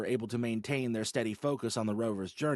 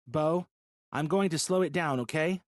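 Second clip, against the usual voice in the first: plain; 7 semitones higher, 5 dB louder than usual. Acted. Holly warns the driver.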